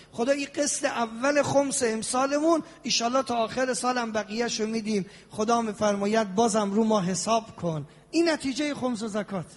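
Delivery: ordinary delivery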